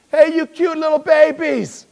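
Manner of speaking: high, loud, drawn-out holler; loud calling out. Acted. Preacher playing Jesus hailing disciples as little babies, loud high holler. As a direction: call out loudly, stretching the words.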